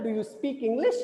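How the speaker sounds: rising tone on "English". Shown as a demonstration. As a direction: lift the voice at the end of "English". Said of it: "English पे आपका rising tone है". ("English" पर चढ़ता हुआ स्वर)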